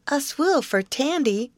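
put-on high-pitched small boy's baby talk; Paul, crafty. A narrator's reading. Small twin striking a deal for candy, crafty baby talk. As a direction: bargain in a little child's high voice. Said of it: "stipulated Paul craftily".

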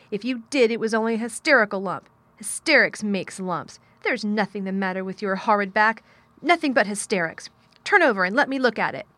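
loud, scolding dismissal; fierce. A narrator's reading. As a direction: scold the fear out of him. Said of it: "contradicted Mary fiercely".

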